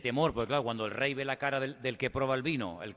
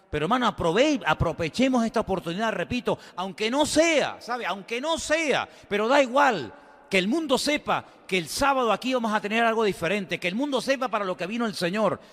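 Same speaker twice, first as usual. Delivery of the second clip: high, loud preaching at full force; fervent exhortation; 7 semitones higher, 5 dB louder than usual. Real, not acted. Preacher urges brothers to seize the opportunity, fervently loud. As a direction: cry it out to rouse the whole church.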